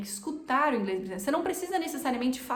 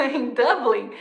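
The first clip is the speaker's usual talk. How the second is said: laughing as she fixes the city name; laughing correction; 8 dB louder, slower than usual. Real, not acted. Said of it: "é?".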